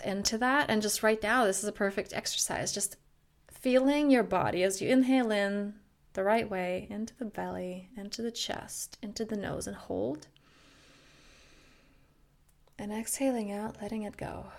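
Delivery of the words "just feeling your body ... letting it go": guided breathing meditation. Acted. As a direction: gently lead each breath in and out. Leads a breathing exercise.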